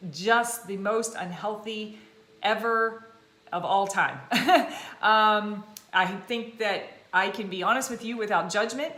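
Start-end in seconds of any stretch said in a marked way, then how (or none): 4.2-5.0 short burst of real laughter; quick loud chuckle; bright laugh. Real, not acted. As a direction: laugh at yourself. Laughs at own past.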